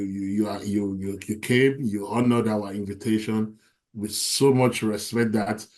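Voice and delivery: usual speaking voice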